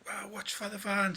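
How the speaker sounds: a sudden high cry of alarm; reliving the shock of the van cutting in; startled warning. Retold or imitated. Driver calling out as the van cuts in, high startled yelp.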